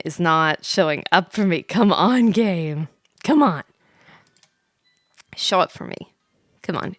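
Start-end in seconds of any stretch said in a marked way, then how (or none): none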